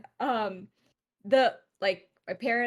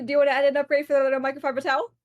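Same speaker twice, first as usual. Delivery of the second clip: rapid upsell; 2 semitones higher, 4 dB louder, much faster than usual. Retold or imitated. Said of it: "she's like".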